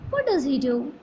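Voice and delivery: "What does he do?" with a rising question tune. Shown as a demonstration. Teacher showing students how to ask a question. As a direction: let "do" lift like a real question. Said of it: "so say like a question".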